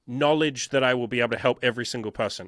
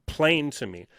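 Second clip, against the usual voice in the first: usual speaking voice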